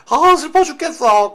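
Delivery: slow, high, hammed-up wail of sorrow; mock grief. Teacher parodies the poem's speaker moaning over a breakup, hammed-up wail. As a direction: overplay the heartbreak, whining it out.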